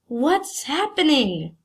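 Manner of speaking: usual speaking voice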